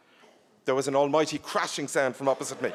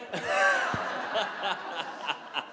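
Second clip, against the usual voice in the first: helpless fading laugh; real laugh; 4 semitones higher than usual. Real, not acted. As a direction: let the laugh run out. Reader laughs at own slip.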